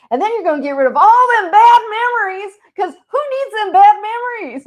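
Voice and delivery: high, loud imitation of the friend; friend's blunt verdict. Guest imitating her Texan friend on grief, high and loud. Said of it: "And he's like".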